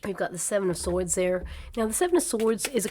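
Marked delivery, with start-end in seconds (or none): none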